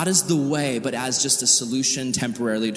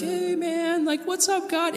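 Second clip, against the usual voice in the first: high, sing-song hey man; mock casual greeting. God mocking Israel's breezy hello, high and sing-song. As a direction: toss it off like a careless buddy. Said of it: "be like".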